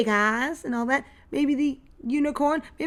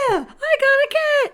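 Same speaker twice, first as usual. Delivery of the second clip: much higher, louder, slow delighted squeal; thrilled squeal; 9 dB louder, slower than usual. Real, not acted. Vlogger unboxing a cat figure for viewers, thrilled squeal.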